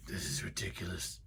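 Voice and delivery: a hint of an annoyed man; muttered mock complaint. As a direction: grumble it to yourself. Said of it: "he was just like".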